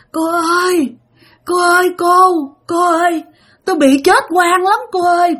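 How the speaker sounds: high, wailing plea of a dead woman; crying ghost woman. Retold or imitated. Old ghost woman begging the girl for help, high and tearful. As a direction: beg through tears like a wronged ghost. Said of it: "Mà vừa nói vừa khóc nha".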